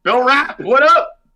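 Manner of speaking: big loud hype call; loud hype greeting. Real, not acted. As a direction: shout the greeting. Host greeting guest loudly.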